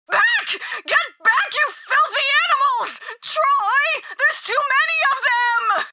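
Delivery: very high, frantic yell; alarmed shriek at a crowd; panicked shouting. Acted. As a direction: shout them back, then call for help. Leader mobbed by followers.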